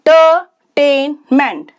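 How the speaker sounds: Entertainment broken into four slow beats; syllable by syllable. Shown as a demonstration. Teacher showing four sounds, entertainment said syllable by syllable. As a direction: split entertainment at every syllable. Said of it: "शब्द को तोड़कर sound change".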